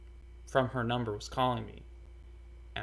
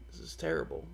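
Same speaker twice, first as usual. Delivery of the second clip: quiet dismay; 8 semitones lower, 9 dB quieter, faster than usual. Retold or imitated. After learning of her death.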